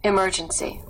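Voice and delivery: usual speaking voice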